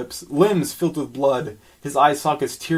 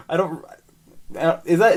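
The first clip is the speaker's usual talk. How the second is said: ordinary talk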